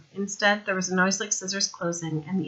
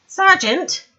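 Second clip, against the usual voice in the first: high, loud call up the ladder; 12 semitones higher, 9 dB louder than usual. Acted. Sandra calling up the ladder to the sergeant, loud call. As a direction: shout up to get his attention. Woman calls up to Vimes.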